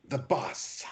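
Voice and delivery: scratchy voice. Acted. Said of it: "in a scratchy voice".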